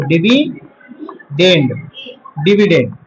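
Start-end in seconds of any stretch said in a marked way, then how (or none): 0.1-1.7 very slow, split DIVI - DEND; syllable by syllable. Shown as a demonstration. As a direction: break "dividend" in two with a long gap. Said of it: "याद रखना इसको".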